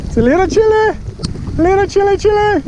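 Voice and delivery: high giddy voice. Real, not acted. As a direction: squeak it out, chilly and giddy. High voice in cold rain.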